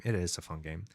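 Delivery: talking through a burp; a burp catching the words. Real, not acted. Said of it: "I'm keep burping here".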